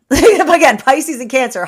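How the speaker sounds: real laugh breaking into speech, high and loud; loud, high-pitched giggly delivery. Real, not acted. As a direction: crack up while talking. Cracking up at own joke.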